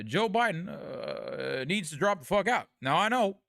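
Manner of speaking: a rusty take on Obama's measured cadence; Obama impression. Streamer shows chat his Obama, slightly rusty Obama voice. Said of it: "that's my Obama".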